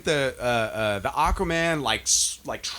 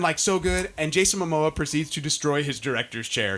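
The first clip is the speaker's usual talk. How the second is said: usual speaking voice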